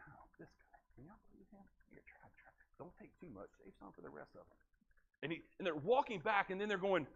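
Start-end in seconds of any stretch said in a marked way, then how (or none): 0.1-4.6 breathy whisper, grudging and secretive. Acted. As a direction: whisper as if sharing a complaint behind his back. Disciple John whispering while handing out.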